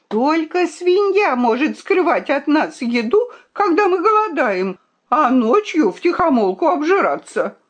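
high-pitched, heated tirade; indignant accusation. A narrator's reading. The princess accuses him of hiding food, indignant.

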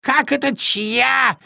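high, drawn-out indignant squawk; ruffled raven. A narrator's reading. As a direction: fire back in high disbelief. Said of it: "взъерошился ворон".

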